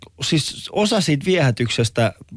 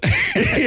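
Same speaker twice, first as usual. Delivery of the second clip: real burst of laughter. Real, not acted. Laughing at the friend's pickup story. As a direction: crack up at the memory.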